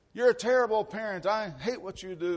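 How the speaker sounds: mock angry kid. Pastor imitating his complaining kids, a mock angry whine. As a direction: ham up a kid griping at a parent.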